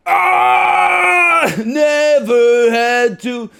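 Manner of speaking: high, very loud, slow drawn-out scream; imitated screamed line. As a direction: scream the words, stretching them out. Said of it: "what is that scream".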